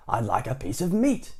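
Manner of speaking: usual speaking voice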